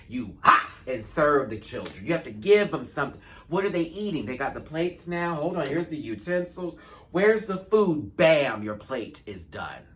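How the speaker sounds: loud, high diva hype; over-the-top drag queen serving the audience; hammed-up serving. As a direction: shout it like feeding a hungry crowd. Acting out serving the crowd.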